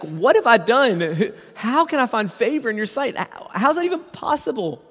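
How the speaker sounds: incredulous questions climbing very high; acted amazement. Preacher voicing Ruth at finding favor, acted astonishment. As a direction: stretch the first question, then rise in disbelief.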